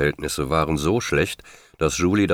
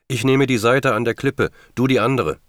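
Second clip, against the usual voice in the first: raised, high-pitched storytelling shout; shouting against wind; 8 semitones higher, 4 dB louder than usual. A narrator's reading. John to the searchers against whipping wind, shouting. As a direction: call it out over a storm. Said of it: "schrie John gegen den peitschenden Wind an".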